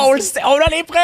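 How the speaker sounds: loud, high interrogation voice; bad cop shouting. Bad cop interrogation role-play.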